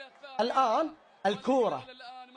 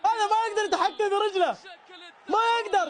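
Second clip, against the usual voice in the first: mock crying player; 10 semitones higher than usual. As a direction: ham up a tearful player who can't control his leg. Mock crying as the penalty taker.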